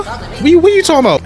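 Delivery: squeaky high what-are-you-talking-about; confused huh. Retold or imitated. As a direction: sound totally lost and thrown. Steven confused by Lapis' words.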